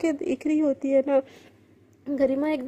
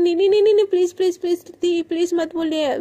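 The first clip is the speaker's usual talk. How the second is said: loud, frantic nos rising high; panicked girl begging the older roommate; panicked pleading; 3 semitones higher, 4 dB louder, faster than usual. Acted. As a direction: beg her frantically not to tell. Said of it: "एकदम घबरा जाती है और बोलती है".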